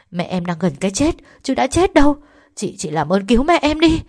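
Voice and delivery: loud, high, frantic pleading; protest, then beg for mother's life; desperate protest. Acted. Girl begs nurse to save mother.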